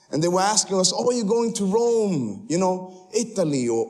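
usual speaking voice

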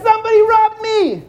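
robbed man crying out. Acted. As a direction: shout it in panic.

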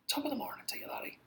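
breathy mock Irish whisper; whispered mock Irish brogue; whispered Irish greeting. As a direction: hush it like a secret, Irish lilt. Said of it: "why are you screaming".

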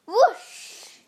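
high, loud whoosh with a long hiss. A narrator's reading. Pipes ripped from the freezers, sudden loud whoosh. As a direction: imitate a sudden rush of icy air. Imitated icy blast.

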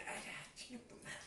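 whispered, slow, smug aside. Retold or imitated. Young man seeing the girl watch him, whispered smug aside. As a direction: breathe it to yourself, pleased. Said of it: "Y yo así como que dije".

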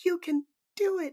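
very high, tiny chirpy squeak; sing-songy bird-like voice. Retold or imitated. Shy girl cheering on the runner, tiny bird-like voice. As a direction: imitate a teeny, timid little cheer. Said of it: "sing-songy bird-like voice".

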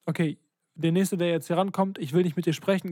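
ordinary delivery

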